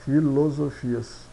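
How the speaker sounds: ordinary delivery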